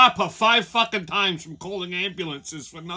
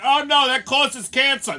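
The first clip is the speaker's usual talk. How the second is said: mock scream; 8 semitones higher, 8 dB louder, slower than usual. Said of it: "she starts screaming".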